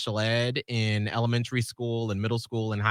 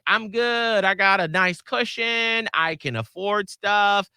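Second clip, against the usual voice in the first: higher-pitched voice of the well-off; mock self-assured; 8 semitones higher, slower than usual. Imitating people with a nice cushion, mock self-assured. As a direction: lift the pitch, imitating someone comfortable. Voicing the few comfortable people.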